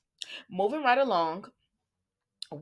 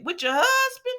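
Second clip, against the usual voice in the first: very high, louder shocked question; incredulous question; 4 dB louder than usual. Retold or imitated. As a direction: squeal it in disbelief. Brooklyn's confused reply.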